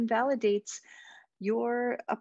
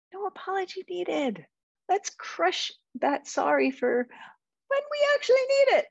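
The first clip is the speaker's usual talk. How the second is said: emphatic rallying cry; 11 semitones higher, 3 dB louder than usual. Real, not acted. Coach urging listeners to crush needless sorries, high and emphatic. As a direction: sing it out high like a cheer.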